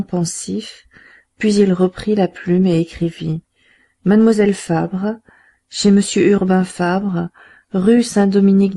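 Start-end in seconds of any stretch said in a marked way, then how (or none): none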